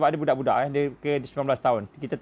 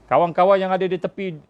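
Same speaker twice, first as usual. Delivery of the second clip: normal talking voice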